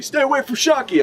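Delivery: high, hammed-up possessive warning "Stay away from Shaki."; mock jealous pirate. Pirates fighting over her.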